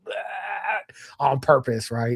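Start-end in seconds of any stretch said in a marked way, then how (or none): 0.1-0.9 higher fake gagging noise; mock retch. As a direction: pretend to throw up, softly. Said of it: "this fucking close to just going".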